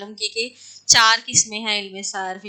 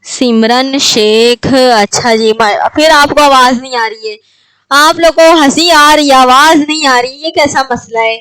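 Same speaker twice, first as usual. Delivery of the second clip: loud, exasperated teacher calling the class to order; annoyed teacher raising her voice at a noisy class; raised scolding voice; 2 semitones higher, 9 dB louder than usual. Real, not acted. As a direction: call out over the giggling students. Teacher scolding laughing students.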